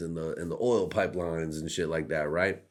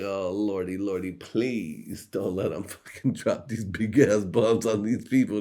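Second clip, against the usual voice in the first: higher, slowed mock prayer; mock praying; 3 semitones higher, 4 dB louder than usual. Comedian imitating himself praying the bombs stop. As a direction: plead to the lord, half-hammed. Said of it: "I was like".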